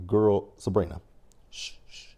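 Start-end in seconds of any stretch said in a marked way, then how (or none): 1.5-2.1 softer, unvoiced shushing; softer teasing shh as if keeping a secret; hushing. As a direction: hush the room like spilling gossip. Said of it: "Keep it quiet".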